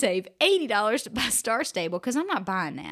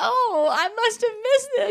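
put-on much higher, louder, laughing kid voice; high little-girl voice; 12 semitones higher, 6 dB louder than usual. Daughter finding the money, high and giggly. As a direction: squeak it like a fooled child.

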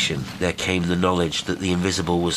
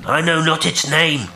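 high, raised voice from across the water; loud, high reply from afar; calling out; 11 semitones higher, 6 dB louder than usual. A narrator's reading. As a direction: call the answer back to the ship. Unseen stranger answers from the water.

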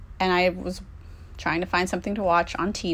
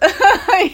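loud laugh; 12 semitones higher, 9 dB louder than usual. Real, not acted. Laughing at her own setup to the listener. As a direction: burst of high loud laughter.

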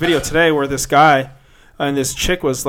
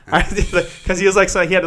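normal talking voice

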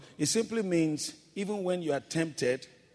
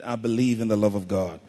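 firm, louder, low-pitched declaration; like you mean it; 4 semitones lower, 7 dB louder, faster than usual. Shown as a demonstration. Preacher showing congregation how to declare belief, like you mean it. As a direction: say it with conviction, pressing each word. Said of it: "say it like you mean it".

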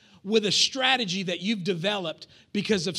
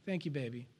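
soft reply; 7 semitones lower, 11 dB quieter than usual. Retold or imitated. Mom's weary reply.

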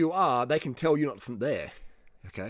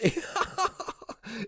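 sudden high burst of laughter; 9 semitones higher than usual. Real, not acted. Vlogger laughing at his own advice, high burst of laughter. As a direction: laugh at the crazy idea.

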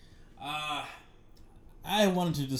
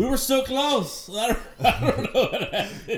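laughing; 9 semitones higher, 7 dB louder than usual. Real, not acted. Laughing with cohost after they just missed, high and slowed. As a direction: laugh through the words.

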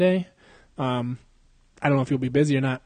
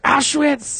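high, louder yelp; mock yelled curse; 12 semitones higher, 4 dB louder than usual. Host acting out a sudden hit, mock high yell. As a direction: cry it out like a swear word.

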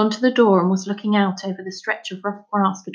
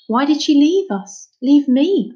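tight thread of a voice; 6 semitones higher, 4 dB louder than usual. Acted. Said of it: "his voice coming out in a tight thread".

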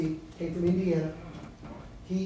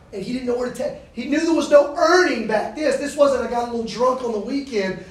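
raised, louder pulpit voice; full-force preaching; 4 semitones higher, 9 dB louder than usual. Acted. Preacher to freshmen, building to the good news, full-force preaching. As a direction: drive it home to the room.

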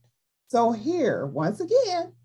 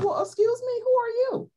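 much higher, sneering demon asking who are you; mocking demon voice. Demon mocking the priest, high and sneering.

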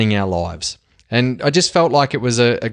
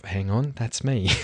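softer, under-the-breath self-correction; sheepish aside; 8 dB quieter than usual. Acted. Host realising he just called himself a legend, softer sheepish aside. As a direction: catch yourself mid-introduction, half to yourself.

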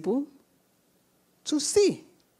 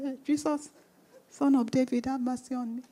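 soft, subdued mock plea; timid, half-hearted little cry for mercy; quiet cry; 10 dB quieter, faster than usual. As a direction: murmur it meekly, as if barely asking. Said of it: "Do you think it was a quiet cry?".

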